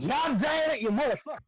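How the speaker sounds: high, loud furious curse; angry yell. Real, not acted. Player raging at the game master, yelling. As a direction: scream it at the dice. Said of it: "Sound plumb agitated".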